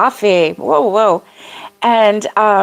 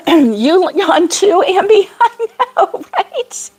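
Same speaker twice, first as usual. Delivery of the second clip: high, laughing glee; thrilled a viewer yawns too; bubbling, high-pitched delight; excited; 9 semitones higher, slower than usual. Real, not acted.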